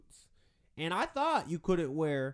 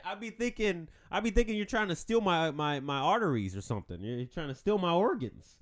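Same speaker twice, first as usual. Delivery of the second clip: ordinary delivery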